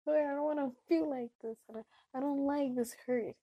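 acted-out hurt, without real sobbing; tearful prayer. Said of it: "I'm praying and I'm crying".

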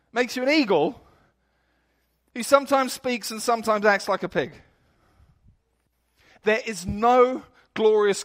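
loud, driving sermon voice; preaching at full force. Acted. Preacher urging New England listeners about their new identity, loud and forceful. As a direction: hammer the truth home to the crowd.

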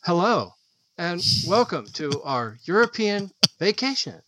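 hammed-up travel show intro; mock PBS travel host. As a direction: parody a TV host welcoming viewers. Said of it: "I'll be standing there and be like".

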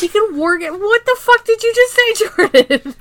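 loud, high-pitched real disbelief; incredulous outburst. Real, not acted. Podcaster reacting to co-host's absurd theory, loudly incredulous. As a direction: burst out in laughing-shocked disbelief, voice shooting up.

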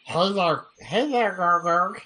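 put-on high nerdy kid voice; fedora neckbeard. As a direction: play a whiny nerdy kid. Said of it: "Oh my God".

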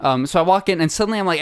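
ordinary delivery

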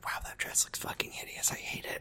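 barely audible, voiceless catty whisper; gossipy whisper. Retold or imitated. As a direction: whisper the insult behind her back.

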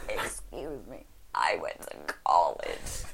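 very low whispery croak on the in-breath; inhaled croak voice. Acted. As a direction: croak slowly while breathing in. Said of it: "speaking on the intake of breath".

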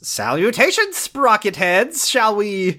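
high, slow grandiose proclamation; hammy steampunk suitor. Pretend. Steampunk man inviting girls to prom, hammed-up grandeur. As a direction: stretch it out like a pompous gentleman caller.

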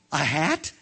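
gasp, then high astonished question; startled discovery. Acted. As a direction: gasp and question what was found. Boy discovers hat on head.

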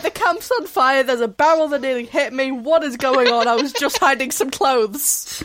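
loud, hammed-up alarm; overwhelmed, loudly protesting at the chaos; panicked outburst. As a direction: play a bewildered bystander in a mock panic. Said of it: "Sorcerer was not prepared".

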